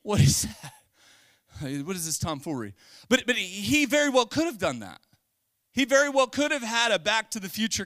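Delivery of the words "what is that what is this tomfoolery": mock bewilderment. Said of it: "They'd have been like".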